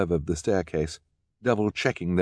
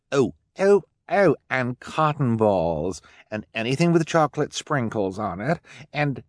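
high, slow excited snob; gushing snob Esme; 5 semitones higher, 3 dB louder, slower than usual. Acted. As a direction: gush over the trend. Esme gushes trends.

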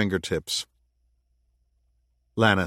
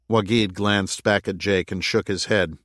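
ordinary talk

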